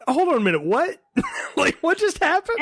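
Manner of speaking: high, laughing disbelief; mock bewildered outburst; baffled. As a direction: play it stunned and laughing. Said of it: "going like".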